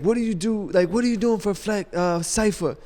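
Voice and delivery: high-pitched mock interrogation; imitated screaming. Radio veteran grilling the new guy, mock yell. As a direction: imitate a boss grilling a newcomer, no real volume. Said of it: "He's just screaming on me".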